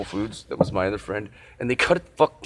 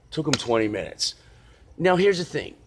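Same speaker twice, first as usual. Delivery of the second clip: normal talking voice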